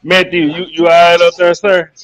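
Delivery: calling out loud to someone far up; shouting up scaffolding. Real, not acted. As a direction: call up to him, loud and high. Calling up to Matthew.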